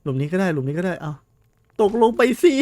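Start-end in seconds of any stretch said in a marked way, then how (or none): 1.8-2.5 much higher, much louder yell of ตกลงไปสิ; desperate yell. Real, not acted. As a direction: scream ตกลงไปสิ at the monster with everything. Urging monster into the pit again. (ตะโกนสุดเสียง)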